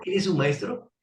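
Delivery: cheeky mock question, tossed off. Silently mocking the light, asking if it is a master. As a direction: ask it teasingly, not believing a word. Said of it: "burlonamente le digo".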